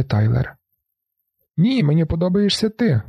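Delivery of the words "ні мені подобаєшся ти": high, insistent shout. A narrator's reading. Marla insisting to the narrator, shouting. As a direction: yell it to convince him. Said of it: "кричить Марла".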